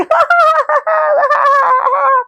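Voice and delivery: very high, drawn-out fake bawling; mock crying. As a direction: imitate a girl wailing in tears. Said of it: "also crying".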